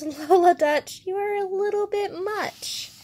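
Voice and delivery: high, lightly sing-song protest, softening at the end; mock overwhelmed. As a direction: playfully voice a put-upon storybook bear. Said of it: "poor Bear".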